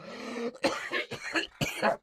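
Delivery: hacking cough; real coughing fit. Real, not acted. Streamer recovers after throat-shredding impression, coughing. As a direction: cough hard from a strained throat.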